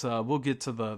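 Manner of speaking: usual speaking voice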